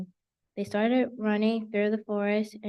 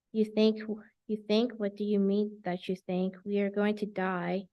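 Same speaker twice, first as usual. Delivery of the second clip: usual speaking voice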